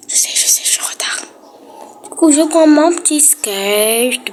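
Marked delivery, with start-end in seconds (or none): 0.1-1.2 whispered guilty aside. Real, not acted. Aside while admitting she's late.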